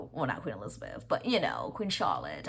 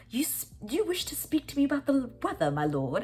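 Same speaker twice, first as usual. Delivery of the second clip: prim lady; 10 semitones higher than usual. Retold or imitated. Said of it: "And she's like".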